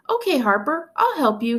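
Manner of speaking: put-on high, keen child's voice; a small boy happy to help; little brother offering help; eager little boy. A narrator's reading. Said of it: "he said".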